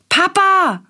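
put-on high, loud call of a small boy; child calling out. A narrator's reading. Willi calling Papa back from bed, high and loud. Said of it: "ruft Willi".

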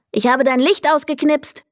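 plain reading voice

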